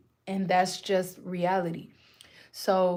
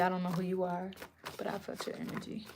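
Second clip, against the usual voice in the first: whisper; 10 dB quieter, faster than usual. Acted. Repeats greeting to unseen viewer.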